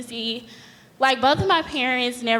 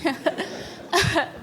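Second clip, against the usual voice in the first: nervous laugh; 7 semitones higher than usual. Real, not acted. Nervous laugh after admitting shakiness.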